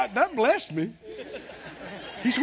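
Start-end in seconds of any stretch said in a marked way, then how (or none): none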